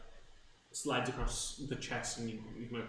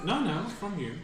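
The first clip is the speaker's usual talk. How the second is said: insistent; 8 semitones higher, 6 dB louder than usual. Acted. Dealer insists, louder, higher.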